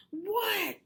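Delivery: Froggy answering his mother again; yell. A narrator's reading. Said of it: "yelled Froggy".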